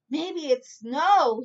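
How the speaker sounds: high, loud and slow with wonder; excited. Acted. Corduroy to himself, excited and wondering. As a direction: gasp it out like a child seeing snow. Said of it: "he said excitedly".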